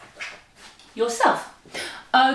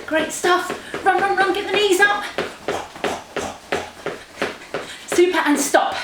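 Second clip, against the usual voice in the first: energetic coaching; 10 semitones higher than usual. Real, not acted. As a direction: spur them on, pitched right up. Coach cheering runners on.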